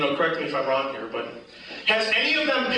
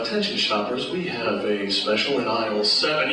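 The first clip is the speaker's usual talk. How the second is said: low store PA announcer; 9 semitones lower than usual. Retold or imitated. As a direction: read it like a Kmart PA. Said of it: "that guy at Kmart that's always announcing the specials".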